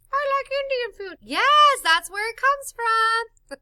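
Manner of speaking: high, squeaky little teddy bear voice. Pretend. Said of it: "I'm making our little bear talk".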